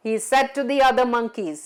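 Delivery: plain reading voice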